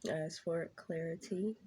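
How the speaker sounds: soft, hushed prayer; reverent, softly spoken invocation; opening prayer. Acted. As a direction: pray quietly before the reading. Opening prayer before tarot read.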